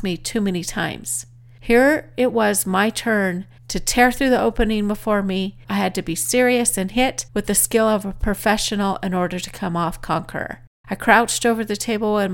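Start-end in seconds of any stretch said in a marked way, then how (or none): none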